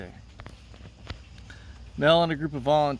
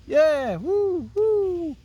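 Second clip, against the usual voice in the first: much higher, louder whooping cheer; crowd cheering; 4 dB louder, much slower than usual. Acted. Teacher voicing the beach crowd at the release, high whooping cheer.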